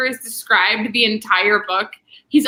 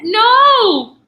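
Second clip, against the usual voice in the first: higher, louder shocked protest; dismayed no; 11 semitones higher, 7 dB louder than usual. Real, not acted. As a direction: cry out in dismay. Dismay at running out of books.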